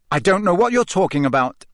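cold Harry. A narrator's reading. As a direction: deny it, mildly cold. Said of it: "Harry lied coldly".

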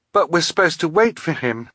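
put-on high, upset girl's voice; worried, high-pitched objection; distressed protest. A narrator's reading. Violet distressed at losing her friend.

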